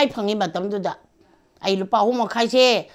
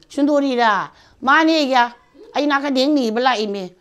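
louder and higher, pressing the point; raised voice; 6 semitones higher, 6 dB louder than usual. Retold or imitated. Answering Songkha at the door, raised and insistent. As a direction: insist loudly who is asking.

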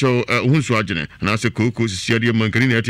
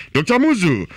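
hyped ad call; 6 semitones higher than usual. Acted. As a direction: announce the doctor like a promo. Ad name call.

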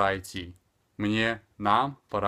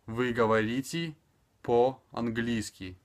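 "Вы говорите" joined, then a pause before slow "по-английски"; careful, slow phrase-by-phrase model; slower for learners; slower than usual. Shown as a demonstration. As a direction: draw out "по-английски" clearly. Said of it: "speaking extremely slower".

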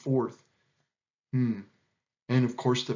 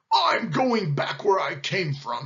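slow, higher, indignant roar; roaring lion; 5 semitones higher, 3 dB louder, slower than usual. A narrator's reading. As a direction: declare it with heavy indignation. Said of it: "he roared".

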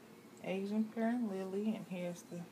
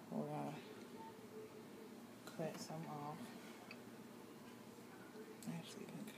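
whisper; 14 dB quieter, faster than usual. Real, not acted. Whispering over the cutting.

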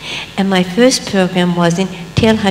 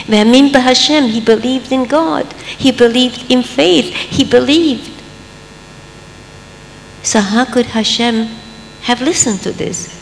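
usual speaking voice

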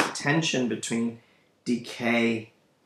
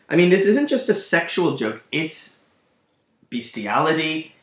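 ordinary delivery

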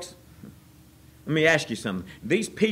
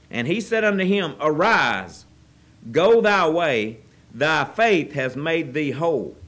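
loud, slow proclaiming of the verse; forceful scripture reading; 4 dB louder than usual. Acted. Preacher rereading the verse to the congregation, louder and proclaiming.

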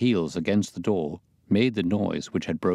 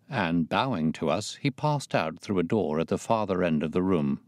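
ordinary delivery, nothing marked

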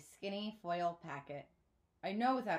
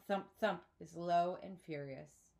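plain reading voice

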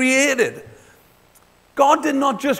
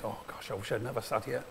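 mock inner groan; 12 semitones lower, 14 dB quieter, faster than usual. Roger's inner thought, retold.

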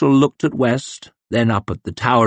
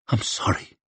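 soft whispered apology, barely voiced; muttered to himself; 9 dB quieter than usual. A narrator's reading. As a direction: breathe it out under your breath. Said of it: "he muttered to himself".

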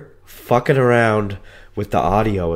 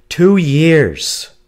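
emphatic loud repeat; 7 semitones higher, 8 dB louder than usual. Real, not acted.

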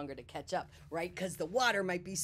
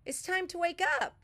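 calling out; 11 semitones higher, 4 dB louder, faster than usual. A narrator's reading. Bear calling for Smile.

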